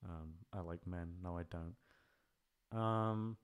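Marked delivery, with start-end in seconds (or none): none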